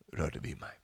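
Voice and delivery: a hushed whisper as if sensing one touch. Shown as a demonstration. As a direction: whisper the question, trailing away. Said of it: "Nej, han säger".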